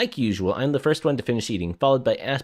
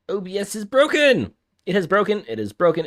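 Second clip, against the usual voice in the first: higher, louder dismayed exclamation; alarmed outcry; 8 semitones higher, 4 dB louder than usual. Real, not acted. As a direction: cry out in dismay as it breaks. Streaming software crashes.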